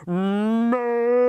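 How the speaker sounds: loud, full moo; cow mooing. Acted. Big strong cow mooing loudly. Cow's call.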